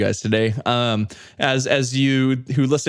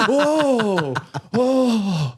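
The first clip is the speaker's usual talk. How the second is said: mock surprised whoa; 9 semitones higher than usual.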